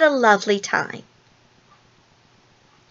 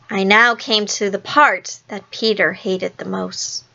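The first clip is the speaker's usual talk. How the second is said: ordinary delivery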